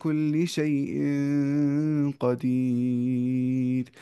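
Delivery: slow, melodic Quran chant; Quranic recitation. Shown as a demonstration. Teacher chanting a verse for tajweed students, slow and melodic. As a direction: draw the verse out in a measured chant.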